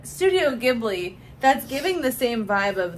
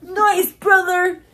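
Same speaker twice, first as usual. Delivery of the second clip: excited shout; 9 semitones higher, 10 dB louder, much slower than usual. Real, not acted.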